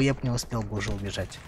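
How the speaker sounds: ordinary delivery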